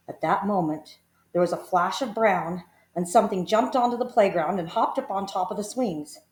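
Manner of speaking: plain reading voice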